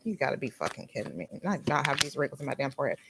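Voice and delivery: quick, soft, low grumble under the breath; muttered disbelief. Retold or imitated. Spotting her first forehead wrinkles, a fast low mutter. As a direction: mutter it fast in annoyed denial. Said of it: "I was like".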